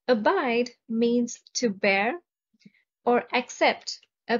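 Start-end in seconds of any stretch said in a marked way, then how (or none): none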